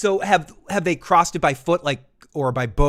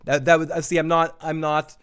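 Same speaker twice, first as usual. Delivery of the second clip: ordinary delivery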